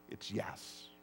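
breathy whispered echo; whispered aside. Real, not acted. Preacher whispering the answer back to the congregation. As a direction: drop it to a whisper after the shout. Soft repeat after loud yes.

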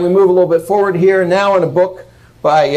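ordinary delivery

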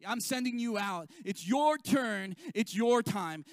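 slow, loud, emphatic sermon refrain; preaching refrain. Acted. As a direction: drive the refrain home to the congregation. Preaching at full force.

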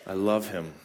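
put-on soft, low mother's voice to her child; tender to her child. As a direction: murmur it to your child. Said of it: "speaks that towards her child".

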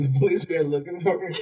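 high-pitched retold disbelief; incredulous echo. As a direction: echo the news back, pitch jumping up. Pupil echoes teacher's news in disbelief.

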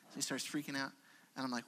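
ordinary talk

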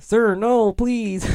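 a tiny elf begging not to be shaken. Retold or imitated.

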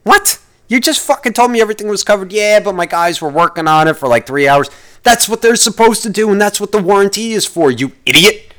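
high, indignant, incredulous rant; outraged. Real, not acted. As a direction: fire back in disbelief. Outraged reply to the price.

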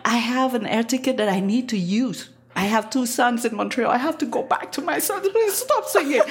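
voix de plus en plus haute, panique jouée; supplication comique. Retold or imitated. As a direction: supplier en montant jusqu'à l'aigu, façon sketch. Supplie Marco d'arrêter de chanter. (supplication paniquée qui grimpe dans l'aigu)

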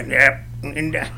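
low, muted Southern simpleton voice; Sling Blade guy. Acted. Comedian imitating the film character, low quiet Southern voice. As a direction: drop low and grunt it out haltingly. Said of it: "Sling Blade guy".

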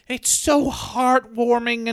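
high, slow mock sentiment; imitating soppy fans; mock gushing. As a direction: coo it as if moved. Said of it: "then people are like".

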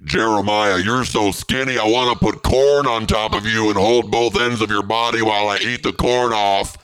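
high, eager, unsettling sweetness; creepy compliment. Acted. Demon gives a creepy compliment to a nervous friend. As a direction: gush it a bit too hungrily. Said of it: "That one was a little creepy".

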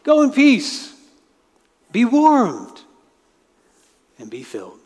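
high, breezy send-off; airy goodbye, then trailing off; dismissive well-wishing; brushing off the needy. Acted. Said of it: "They say to the needy person".

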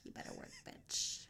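hushed, voiceless whisper of the hook; whispered echo. Acted. Friend reading [name] lyrics to the others, whispered.

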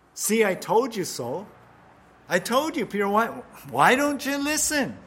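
high, loud, exasperated scolding; scolding I told you. Pretend. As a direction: scold a student who never listens. Imagined scolding look of Jesus.

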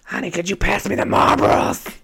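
hammed-up rough smoker voice; Edith. Asking for cigarettes in character, exaggerated smoker's rasp. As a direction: croak it out like a heavy smoker. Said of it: "Edith character is a very heavy smoker".

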